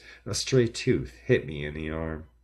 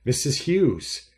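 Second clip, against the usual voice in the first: high, loud, slowed pleading shout; desperate shout; 8 semitones higher, 6 dB louder, slower than usual. A narrator's reading. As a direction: yell after her in desperation. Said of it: "Spencer's tone grew desperate".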